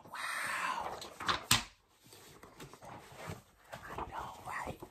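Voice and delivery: breathy hushed wow; whispered amazement at one hundred; awed whisper. Acted. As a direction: whisper it in wonder.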